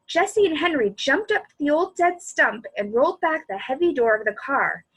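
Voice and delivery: usual speaking voice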